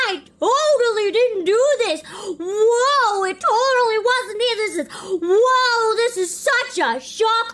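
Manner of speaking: loud, high, stunned exclamations; shocked. Real, not acted. Streamer yelling in shock at a game twist, loud and high. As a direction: gasp and yell in disbelief.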